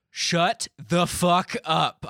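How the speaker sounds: loud, very slow angry yell; heated. Retold or imitated. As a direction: retell a furious order, every word drawn out. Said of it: "got heated, man".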